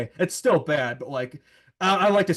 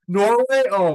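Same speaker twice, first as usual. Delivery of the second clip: surprised; 7 semitones higher, 9 dB louder, slower than usual. Real, not acted. Shocked at losing to Norway.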